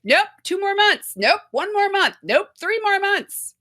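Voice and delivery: high, loud mock doctor refusal; patient telling of doctors saying no again and again, high and loud; doctors saying nope. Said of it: "they're like".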